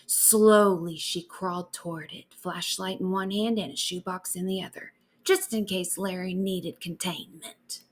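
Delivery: usual speaking voice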